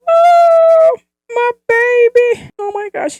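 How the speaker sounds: very high, loud gushing squeal. Retold or imitated. Mom retelling how she gushed over her daughter, squealing high. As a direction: squeal like your heart just melted.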